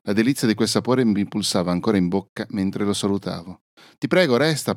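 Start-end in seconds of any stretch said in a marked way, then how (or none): 4.0-4.8 pleading whimper, a little higher. A narrator's reading. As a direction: whimper it as a plea to stay. (supplica piagnucolante)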